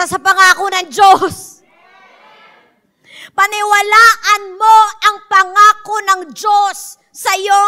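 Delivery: loud, fervent preaching; preaching at full force. Acted. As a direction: preach it hard to the crowd. (malakas na pangangaral)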